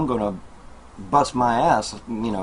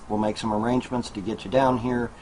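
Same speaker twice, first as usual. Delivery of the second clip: usual speaking voice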